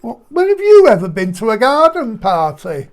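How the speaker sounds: loud mocking disbelief; incredulous. Real, not acted. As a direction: scoff at the idea, loud. Teasing co-host's posh suggestion.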